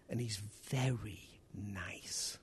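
slow, soft whisper. Acted. Preacher confiding to the congregation, whispered. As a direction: lean in and whisper it. Preacher drops to hushed aside.

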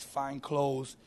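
ordinary talk

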